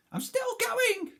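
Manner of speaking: very high, slow, loud crone screech; screeching grandma from the attic; screechy old voice. Acted. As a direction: screech it from far above. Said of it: "the old screechy voice from up above".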